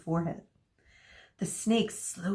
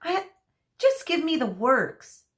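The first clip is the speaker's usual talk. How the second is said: mildly pleading, higher at first; a hint of a desperate plea; 7 semitones higher, 3 dB louder than usual. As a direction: beg for the works, gently. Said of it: "she pleaded".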